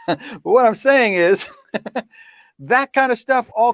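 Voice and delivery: amused, half-laughing delivery. Real, not acted. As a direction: chuckle the line out as you go. Laughing mid-sentence at own point.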